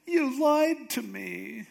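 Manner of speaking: high, slow mock whine; hammed-up injured reproach; wounded accusation. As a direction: draw it out like a hurt girlfriend. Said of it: "so she's going".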